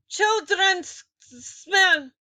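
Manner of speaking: very high, loud witch shriek; scream. A narrator's reading. Grand High Witch screaming her hatred of children. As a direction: shriek it in fury. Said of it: "she screamed".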